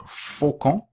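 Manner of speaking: "faucon" said slowly, ending on a nasal vowel, N not sounded; silent final N. Shown as a demonstration. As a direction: say "faucon" as fokɔ̃ with no N at the end. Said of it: "On ne prononce pas le N à la fin". ("faucon" lentement, sans prononcer le N)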